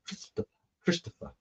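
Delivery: soft, whispery lisp on "Christopher"; imitated lisp mangling "Christopher". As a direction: say "Christopher" with slushy, lisped s sounds. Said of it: "spoke with a lisp".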